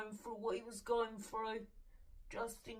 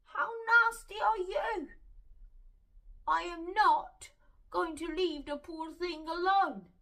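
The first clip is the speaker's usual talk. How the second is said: much higher, louder outraged protest; indignant cry at the so-called friends; 8 semitones higher, 7 dB louder than usual. Retold or imitated. Retold outraged thought.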